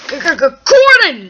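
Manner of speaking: loud scolding shout of a name; angry yell. Acted. Brother yells at Gordon to stop, angrily.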